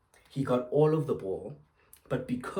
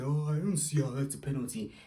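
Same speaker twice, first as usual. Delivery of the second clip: mocking pundit; 2 semitones higher than usual. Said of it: "come out and say".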